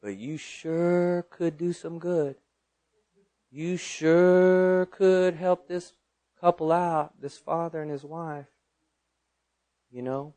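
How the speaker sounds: God's coaxing second voice. Retold or imitated. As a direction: coax without pushing, stretching the first words. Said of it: "the second voice, you know, he spoke again".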